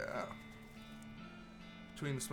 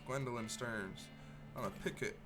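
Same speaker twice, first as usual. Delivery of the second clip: usual speaking voice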